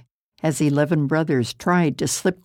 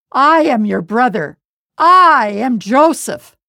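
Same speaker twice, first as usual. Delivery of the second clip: loud and rising higher, slowing on the name; emotional reveal; 6 semitones higher, 7 dB louder than usual. A narrator's reading. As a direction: burst out the truth at last. Joseph reveals himself, weeping.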